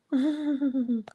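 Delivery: a light laugh under the breath; soft laugh. Real, not acted. Laughing softly while reading the comment. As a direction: laugh quietly at what you read.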